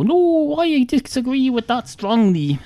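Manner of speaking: high-pitched mock objection; hammed-up, haughty protest, pitched high; high and mighty. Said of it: "all high and mighty and go".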